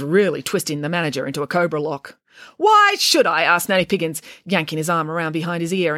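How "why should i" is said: high, loud, indignant retort; indignant refusal; defiant challenge. A narrator's reading. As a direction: throw it back loudly, pitch way up. Nanny defiantly challenges the manager.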